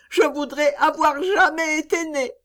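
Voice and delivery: put-on high, despairing child's voice; a child who wishes she had never lived; despairing little girl.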